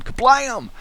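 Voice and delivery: high-pitched comic "kablam"; kablam sound effect. Pretend. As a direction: burst out a cartoon crash. Sound effect for kicked door.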